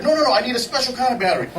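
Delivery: fast, high imitation of flustered insistence; imitated impatient correction. Retold colleague impatiently insisting.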